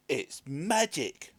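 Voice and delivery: amazed and drawn out; villagers crying out. A narrator's reading. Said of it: "the villagers cried out".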